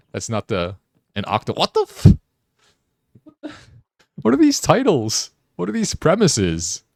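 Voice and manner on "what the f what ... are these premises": much higher and louder, aghast; aghast at what you're reading, pitch jumping up; shocked disbelief. Real, not acted. Shocked at game titles.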